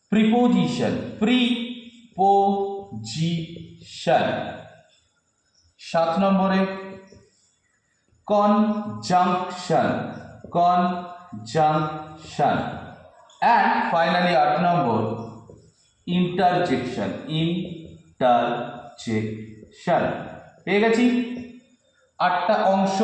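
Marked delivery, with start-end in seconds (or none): 1.2-4.5 preposition very slowly as প্রি-পো-জি-শন; syllable by syllable. Shown as a demonstration. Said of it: "syllable ভেঙে ভেঙে বলতে হবে". 8.2-12.6 conjunction very slowly as কন-জাং-শন; syllable by syllable. Shown as a demonstration. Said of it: "syllable ভেঙে ভেঙে বলতে হবে". 17.3-20.0 interjection very slowly as ইন-টার-জেক-শন; syllable by syllable. Shown as a demonstration. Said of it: "syllable ভেঙে ভেঙে বলতে হবে".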